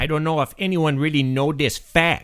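rude foreign guest in broken English; broken-English foreign character. Acted. Comedy guest mocking the host, broken-English accent. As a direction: insult the host in a thick put-on accent.

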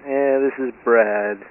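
ordinary talk, nothing marked

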